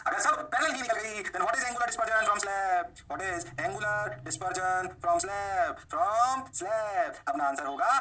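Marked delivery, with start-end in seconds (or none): 1.2-7.2 very slow, stretched words; dragged-out question. Shown as a demonstration. Teacher posing the key slab question, dragged out. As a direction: draw every word right out.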